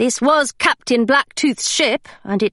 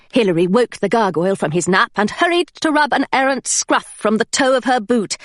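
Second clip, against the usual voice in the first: usual speaking voice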